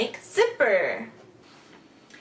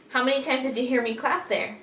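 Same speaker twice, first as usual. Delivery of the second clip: ordinary delivery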